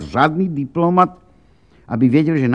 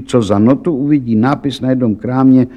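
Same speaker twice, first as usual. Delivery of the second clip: plain reading voice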